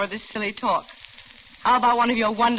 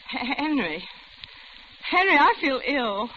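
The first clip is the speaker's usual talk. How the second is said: rising in pitch, pleading as she feels faint; frightened woman realising she's been drugged, voice climbing; growing panic; 7 semitones higher than usual. Acted.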